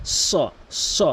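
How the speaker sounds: স with lips rounded, heavy and hissed. Shown as a demonstration. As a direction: round the mouth and hiss স. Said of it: "মুখটা একটু গোলাকার করতে হবে".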